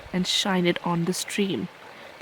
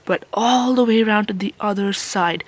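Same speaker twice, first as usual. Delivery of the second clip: ordinary delivery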